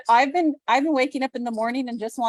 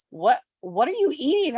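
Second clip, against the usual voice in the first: baffled husband; 5 semitones higher, 4 dB louder than usual. Retold or imitated. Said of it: "husband's looking at my plate like".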